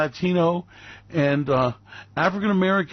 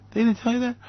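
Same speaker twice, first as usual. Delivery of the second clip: ordinary talk, nothing marked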